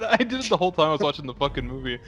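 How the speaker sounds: ordinary talk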